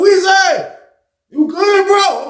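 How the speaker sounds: high, bright call from the homies; excited greeting. Retold or imitated. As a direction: sing out his name in surprise. Friends greeting in the car.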